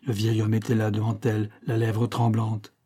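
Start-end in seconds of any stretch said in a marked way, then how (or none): none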